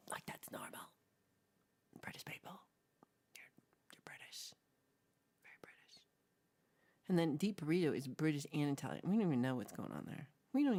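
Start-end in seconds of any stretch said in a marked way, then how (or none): 0.1-0.8 whisper. Acted. Whispered repeat. 2.0-6.0 whisper. Acted. Whispered asides.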